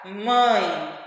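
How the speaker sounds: low, humming nasal "Mãe"; nasal vowel. Shown as a demonstration. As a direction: make the nose vibrate on "Mãe". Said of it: "ele vai vibrar". ("Mãe" bem nasal, com o nariz vibrando)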